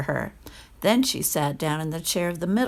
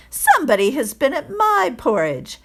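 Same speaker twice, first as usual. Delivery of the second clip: a touch higher, middling bear voice; lightly voiced mother bear, a bit louder; middle-sized bear; 7 semitones higher, 4 dB louder than usual. A narrator's reading. Middle bear notices her porridge, mild middle voice. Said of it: "in her middle voice".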